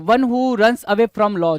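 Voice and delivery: reading the definition out slowly; slow dictation. Shown as a demonstration. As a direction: read it slowly so students can note it down. (धीमी आवाज़ में परिभाषा पढ़ना)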